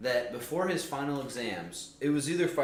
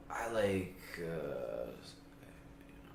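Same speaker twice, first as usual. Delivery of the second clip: very slow, sleepy mumble; stoner; 5 semitones lower, 7 dB quieter, much slower than usual. Retold or imitated. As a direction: drift off like he's dozing. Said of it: "You sound like a stoner".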